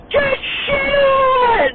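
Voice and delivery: imitated all-out scream, very high and stretched slow; Morgan. Teammate after getting a kill, imitated beastly scream. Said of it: "screaming at the top of his lungs".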